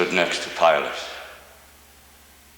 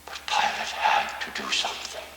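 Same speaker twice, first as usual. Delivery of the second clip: whisper; 6 semitones lower, 5 dB quieter than usual. Acted. Hushed aside about Pilate.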